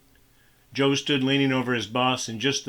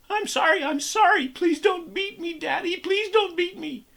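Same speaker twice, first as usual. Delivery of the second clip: put-on much higher, pleading terrified girl; terrified screech; 3 dB louder than usual. A narrator's reading. Daughter begging her father not to beat her, terrified screech. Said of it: "she screeched".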